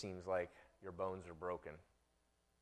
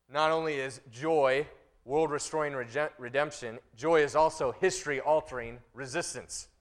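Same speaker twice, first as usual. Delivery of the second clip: loud, high preaching; full-force sermon voice; 7 semitones higher, 10 dB louder than usual. Acted. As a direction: drive it home from the pulpit.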